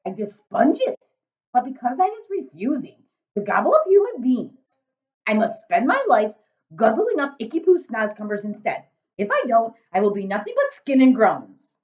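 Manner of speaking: big loud giant, muddling his words. A narrator's reading. Giant grumbling to Sophie about snozcumbers, big booming voice. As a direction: boom it out as a kindly giant.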